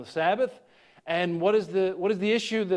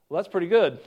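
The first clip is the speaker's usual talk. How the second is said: ordinary delivery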